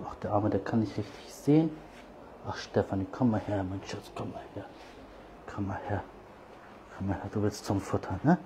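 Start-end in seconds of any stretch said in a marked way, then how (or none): 2.4-8.4 soft, lowered voice calling the cat close; tender coaxing. Real, not acted. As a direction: soothe her and call her over gently. Calling cat tenderly after scolding. (leises, zärtliches Herlocken)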